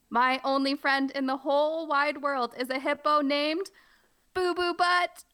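high, building to a silly blurt; silly reading. Acted. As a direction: build up to the punchline. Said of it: "play it up and be funny".

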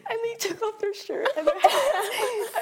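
high, laughing through words; can't stop laughing while telling. Real, not acted.

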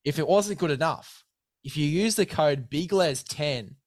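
upbeat sponsor pitch to a mate; hyped ad read. Acted. Host pitching sponsor gear to guest, hyped ad read. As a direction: sell the gear like a mate on air. Sponsor code plug continues.

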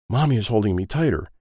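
plain reading voice

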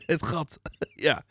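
laughing through the words. Real, not acted. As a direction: chuckle while repeating it. Guest laughs at navel joke.

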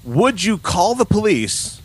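ordinary talk, nothing marked

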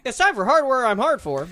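loud, high segment-intro announcer. Acted. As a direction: boom out the segment title.

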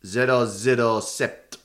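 slow, mock-suave French delivery; French accent. Podcaster playing the admiral as a French agent, French accent. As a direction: introduce a spy in drawn-out French. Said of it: "fun excuse to do accents more".

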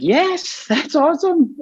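very high, thrilled "yes"; excited. Retold or imitated. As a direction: gush with delight. Self quoted enthusiastic.

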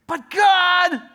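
high, loud whine; whiny protest. Acted. Preacher acting himself whining loudly at God. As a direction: whine like a tired child.

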